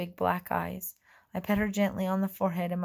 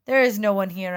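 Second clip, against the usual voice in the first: loud bluff; 8 dB louder than usual. A narrator's reading. Hiding fairy bluffs.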